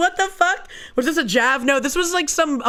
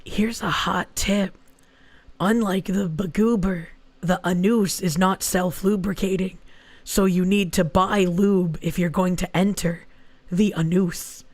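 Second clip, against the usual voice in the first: whisper; 5 semitones lower than usual. Real, not acted. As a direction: share it like a secret. Whispered mock sex tip.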